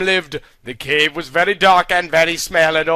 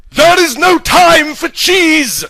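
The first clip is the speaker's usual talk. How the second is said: high, loud yell at the cheese; 11 semitones higher, 7 dB louder, slower than usual. Acted. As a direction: bellow it, stopping the snack. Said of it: "yellowed Gandalf".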